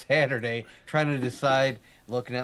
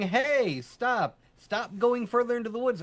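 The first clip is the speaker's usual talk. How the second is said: voices calling; 10 semitones higher than usual. Retold or imitated. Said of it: "you can hear all the voices saying".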